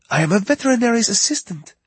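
put-on high young woman with French accent; worried Parisian woman, French accent. French vet assistant voiced.

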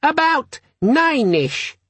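very high, slow gadget announcement; squeaky, friendly. A narrator's reading. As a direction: announce it slowly in a squeaky little demon voice. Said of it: "squeaky, friendly quality that begs".

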